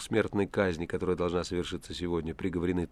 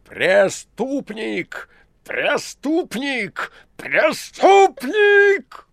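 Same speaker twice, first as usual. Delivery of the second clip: each Преступник slower, much higher and louder, ending in a full shout; building shout; 13 dB louder, much slower than usual. A narrator's reading. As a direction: call it out so the garden hears. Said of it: "Он ещё повысил сорванный командами голос".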